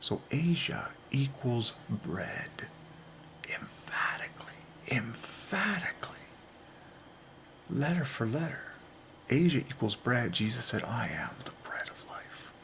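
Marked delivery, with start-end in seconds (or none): 0.0-6.2 slow, hushed and insistent; hushed emphatic whisper. Real, not acted. Hushed summing-up of the claim. 10.8-12.5 soft whispered scripture, lower; whispered words of Jesus; reverent whisper. Acted. Quoting Jesus in hushed reverence.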